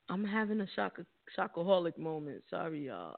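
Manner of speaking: ordinary talk, nothing marked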